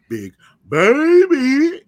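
big stretched-out hype announcement; announcer voice. Acted. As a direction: announce the name like a ring announcer. Host announces own nickname.

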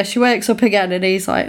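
ordinary delivery

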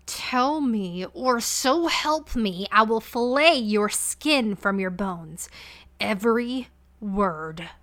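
queen threatening her maid to her face; menacing growl. Acted. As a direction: promise the violence slowly. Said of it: "Valeria growled".

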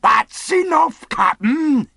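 high, loud, drawn-out holler; cheery shout. A narrator's reading. Long John accepts the captain's word, cheery shout. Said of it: "shouted Long John cheerily".

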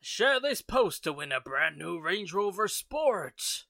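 hammed-up scam post announcer; spam post voice. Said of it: "like those Facebook posts".

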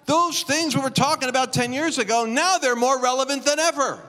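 high, impassioned preaching cry; preacher at full pitch; emphatic preaching. Real, not acted. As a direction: drive the point home to the congregation. Preacher driving home his point.

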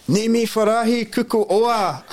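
high, slow, proud Swahili greeting that ends in oa; cheery Swahili greeting. Retold or imitated. To the housewoman, high slow Swahili greeting. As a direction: sing out Nimi furahi kuku oa like a proud learner.

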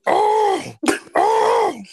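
high, loud frightened yelp; a big man yelping in sudden fright; scared yelp. Retold or imitated. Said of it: "he was like".

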